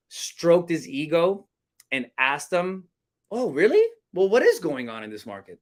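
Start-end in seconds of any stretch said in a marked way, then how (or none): 3.3-5.6 "Oh, really?" pitched up high, then easing down; high-pitched upswing. Shown as a demonstration. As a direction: stroke his ego with a high, curious question. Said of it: "I do it with a high pitched sound".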